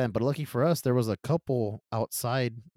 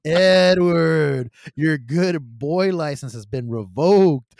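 slow, drawn-out, loud reprimand; hammed-up disappointed telling-off, name stretched out; mock scolding; 8 dB louder, much slower than usual. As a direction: pass a slow mock sentence on a friend. Mock-scolding his friend in retelling.

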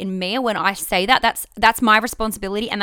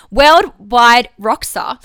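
slow, drawn-out proud claim; 7 dB louder, much slower than usual. Retold or imitated. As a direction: stretch out worldwide rock star slowly.

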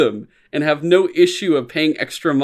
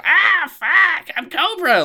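much higher, louder screechy villain squeal; high-pitched Cobra; 6 dB louder, much slower than usual. Shown as a demonstration. Fan showing the Cobra he wants, high and loud. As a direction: squeal it like a petty cartoon villain. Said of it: "I want high-pitched piece of shit".

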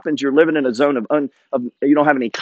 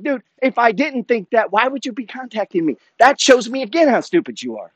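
high-pitched, incredulous comeback; retold heated rebuttal; exasperated retort; 6 semitones higher than usual. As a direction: throw the challenge right back. Retells his retort to agents.

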